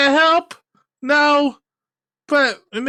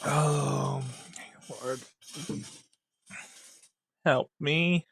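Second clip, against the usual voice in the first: defeated plea; 10 semitones lower, 14 dB quieter, slower than usual. Real, not acted. Despair, stage seven remaining.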